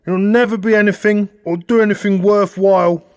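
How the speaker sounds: shouted high and loud, a father putting his son down; scornful father. A narrator's reading. Father telling his son he'll never amount to anything, in a loud high shouted voice. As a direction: bellow it down at the boy like a disappointed dad.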